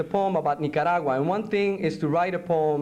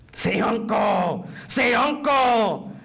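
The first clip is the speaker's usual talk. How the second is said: loud, high, drawn-out command; torturer demanding surrender; 6 semitones higher, 4 dB louder, much slower than usual. Acted. Interrogator forcing a prisoner to give in, loud slow shout. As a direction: shout it slowly, twice, like an order.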